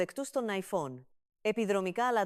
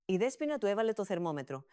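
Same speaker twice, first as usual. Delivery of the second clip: usual speaking voice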